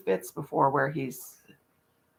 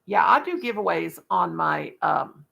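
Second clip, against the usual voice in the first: ordinary talk, nothing marked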